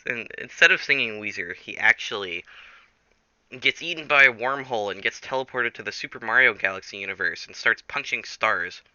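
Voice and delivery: ordinary talk, nothing marked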